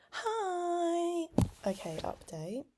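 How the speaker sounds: normal talking voice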